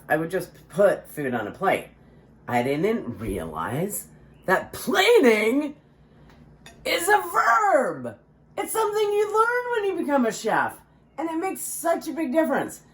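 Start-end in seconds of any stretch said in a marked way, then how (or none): 4.5-12.8 sing-song high. Acted. Showing off chef knowledge.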